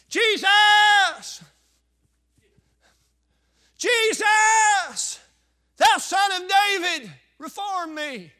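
desperate shout to Jesus; crying out. Acted. Preacher voicing the blind man calling to Jesus, desperate shout. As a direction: cry out high and loud for mercy. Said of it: "he began to cry on the Lord".

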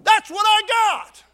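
drawn-out climactic shout; triumphant preaching shout. Real, not acted. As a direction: ring it out in triumph.

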